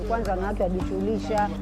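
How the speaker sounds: ordinary delivery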